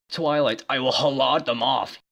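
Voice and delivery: loud brave shout; heroic call. Acted. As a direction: call out to hold them off. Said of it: "he said".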